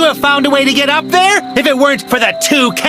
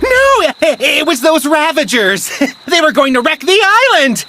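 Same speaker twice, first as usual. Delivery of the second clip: panicked excuse. Acted. Cornered captive blaming the Ravagers to the captor, panicked excuse. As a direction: blurt out the blame in panic. Cornered villain blames others.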